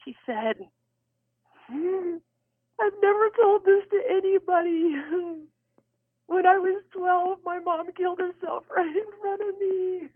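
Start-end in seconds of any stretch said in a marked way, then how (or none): 1.7-10.1 put-on high, tearful little-girl voice; little girl's voice. Cashier confessing to a stranger in tears, little girl's voice. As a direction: imitate a crying child confiding a secret. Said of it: "in this little girl's voice".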